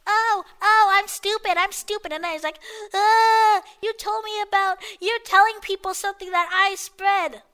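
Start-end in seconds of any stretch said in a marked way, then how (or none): 0.1-2.1 higher whiny-dumb imitation; mocking dumb kid voice; mock idiot. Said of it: "this idiot is like". 2.9-7.4 higher mocking complaint; hammed-up kid whine; mock upset kid. Mocks the kid's upset complaint.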